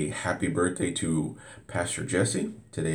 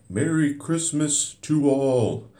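high, slow cartoon narrator; lilting old cartoon delivery; 7 semitones higher than usual. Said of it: "I sound like one of those old cartoons from the seventies".

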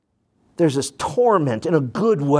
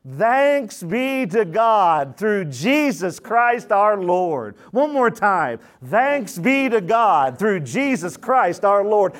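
reading aloud together; 5 semitones higher, slower than usual. Shown as a demonstration. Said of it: "Read it with me out loud".

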